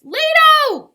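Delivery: high, full-voiced scream of a name; desperate piercing cry. A narrator's reading. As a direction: scream after someone in the water. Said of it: "Isabel cried".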